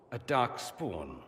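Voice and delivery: slow, weighty "Dark Spawn"; deliberate, grave reveal; slow emphasis. Acted. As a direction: let "Dark Spawn" land word by word.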